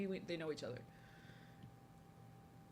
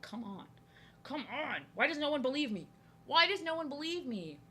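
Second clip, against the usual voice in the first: higher-pitched mock pleading; mock exasperation; 6 semitones higher than usual. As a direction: plead to be believed, hamming it up. Acts out protesting being disbelieved.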